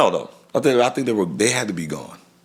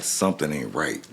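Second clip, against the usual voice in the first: usual speaking voice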